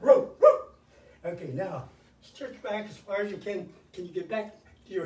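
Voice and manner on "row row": loud high playful barking like a dog; mock dog bark. Fitness host joking with viewers, loud mock dog barks. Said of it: "Who left the dogs out?".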